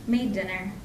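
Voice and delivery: plain reading voice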